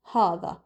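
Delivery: "هذا" with a dark, back "haw" vowel; heavier first syllable. Shown as a demonstration. Teacher repeating the Gulf form, heavy first syllable. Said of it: "they make it heavier".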